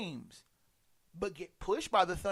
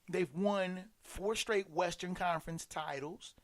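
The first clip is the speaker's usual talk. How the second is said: normal talking voice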